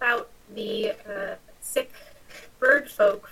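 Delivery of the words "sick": ordinary delivery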